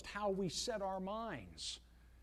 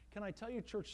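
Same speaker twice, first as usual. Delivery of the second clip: ordinary delivery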